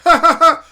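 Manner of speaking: loud high canned laughter; mock laugh-track burst; fake laugh track. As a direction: roar like a studio audience.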